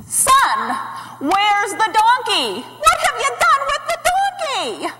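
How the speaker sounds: panicked mother yelling; frantic scolding yell. Acted. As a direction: scold loudly, climbing to a wail. Mother confronts her son, yelling.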